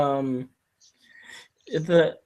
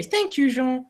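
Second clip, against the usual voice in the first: put-on childish and much higher; kiddish; 12 semitones higher, 3 dB louder than usual. The lady sweetly thanks her chauffeur Jean, childish and much higher. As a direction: thank him like a sweet little kid. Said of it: "in a really kiddish way".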